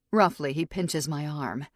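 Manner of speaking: ordinary delivery, nothing marked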